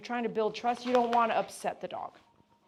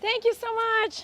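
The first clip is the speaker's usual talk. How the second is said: much higher, louder sing-song cooing; 6 dB louder, slower than usual. Real, not acted. Groomer gushing to the happy dog that daddy arrived, high baby talk. Cheery goodbye.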